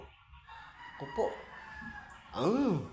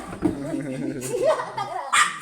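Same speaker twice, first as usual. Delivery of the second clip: high startled laugh; 11 semitones higher, 5 dB louder than usual. Real, not acted. Laughs after the fright.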